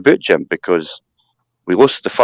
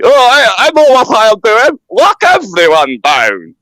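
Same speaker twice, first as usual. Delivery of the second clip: high, loud mock voice, last words slowed; goofy politician mock; 12 semitones higher, 5 dB louder, slower than usual. Mocking the goofy bird who wants lockdowns, high hammed-up voice. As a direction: ham up a smug goofy know-it-all. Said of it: "that goofy bird".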